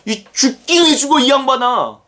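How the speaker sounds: high, loud protest; angry shout. Pretend. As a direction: yell it to stop him dying. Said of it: "당연히 말려야 하는 거야". (스승을 말리는 베드로, 높은 고함)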